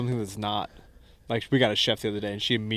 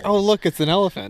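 excited delight; 9 semitones higher, 5 dB louder than usual. Retold or imitated. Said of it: "She's like".

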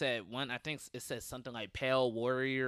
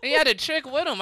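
normal talking voice

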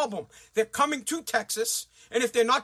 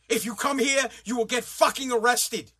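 usual speaking voice

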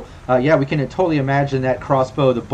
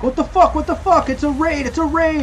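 high-pitched mock yell, little extra volume; acted panicked cry to the others upstairs; yelling out alarm. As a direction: raise the alarm in a strained high voice. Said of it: "as he's yelling out".

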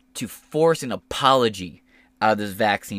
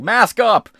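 high, loud command; shouting; 11 semitones higher, 10 dB louder than usual. Retold or imitated. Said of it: "the Republicans were like".

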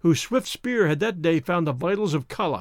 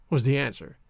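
usual speaking voice

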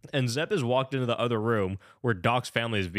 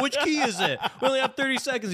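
high-pitched imitation of frantic people; hammed-up high fretting; mock panic; 12 semitones higher than usual. Acting out characters' frantic key hunt.

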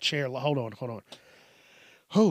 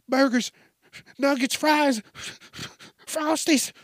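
put-on very high-pitched food-mad kid; crazed food craving; 10 semitones higher than usual. Food-addicted kid chanting fast food orders, crazed. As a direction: pant out each food in a high, desperate voice.

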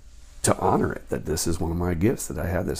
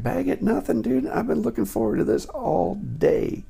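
incredulous excited; 4 dB louder than usual. Retold or imitated. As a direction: squeal it like that's unthinkable. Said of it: "And I'm like".

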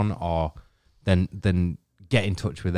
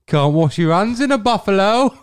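mock Brummy accent; 13 semitones higher, 6 dB louder than usual. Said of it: "That's my sh-, shit brummy gig".

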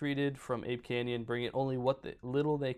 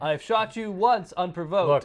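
loud, high warning shout; mock threat bellowed into the dark; threatening prospector; 5 semitones higher, 9 dB louder than usual. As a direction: yell it at the creature like a trigger-happy miner. Prospector voiced threatening creature.

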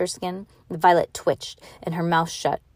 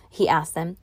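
ordinary delivery

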